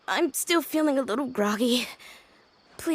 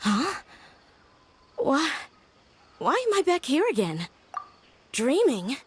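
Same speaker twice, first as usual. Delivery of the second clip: confused surprise; 3 semitones higher than usual. Acted. Wakes confused in old place.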